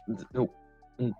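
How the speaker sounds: ordinary talk, nothing marked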